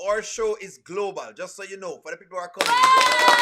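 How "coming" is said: much higher, much louder call-out; excited shout. Real, not acted. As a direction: yell it out to the newcomers. Announcing global show to newcomers.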